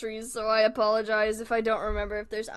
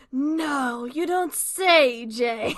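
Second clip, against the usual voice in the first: sarcastic; 2 semitones higher, 5 dB louder, much slower than usual. Real, not acted. Streamer mocking Jay's obvious remark, sarcastic. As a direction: stretch it out with heavy sarcasm. Mock reply to an obvious statement.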